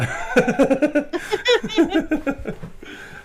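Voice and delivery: high, loud burst of laughter; real laughter breaking out. Real, not acted. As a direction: crack up loudly.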